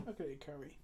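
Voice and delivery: soft, murmured echo; quiet repeat. Real, not acted. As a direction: say it again under your breath. Repeats line, second hushed.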